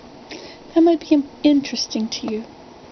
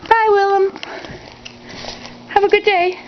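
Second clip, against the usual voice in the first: high, raised sing-out farewell; calling out goodbye; 9 semitones higher than usual. Real, not acted. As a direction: call a goodbye across the house. Calling goodbye to someone leaving.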